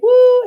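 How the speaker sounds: loud, high cheering "woo"; excited whoop. Retold or imitated. Teacher mimicking elementary schools' reaction, excited whoop. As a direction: whoop it like an eager crowd.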